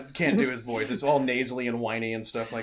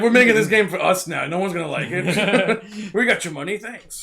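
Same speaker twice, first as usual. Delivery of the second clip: mocking developer voice; 7 semitones higher, 10 dB louder than usual. Mock game developer quote.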